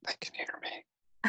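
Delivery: soft unvoiced whisper. Real, not acted. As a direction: hush it like a secret. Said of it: "people have to be able to hear you".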